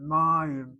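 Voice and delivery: "Mayim" as a loud MA-yim; emphatic "Mayim", stressed at the front; accented first syllable. Shown as a demonstration. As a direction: punch the MA of "Mayim". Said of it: "therefore needs the accent".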